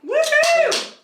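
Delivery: high, loud woohoo; excited cheer. Real, not acted. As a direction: cheer it out with glee.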